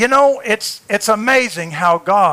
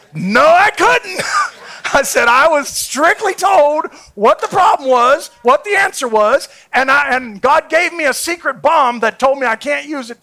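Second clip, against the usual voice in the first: fiery preaching; 8 semitones higher, 6 dB louder than usual. Acted. Preacher retelling his bomb to Matt, full force. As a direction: drive the point home to the crowd.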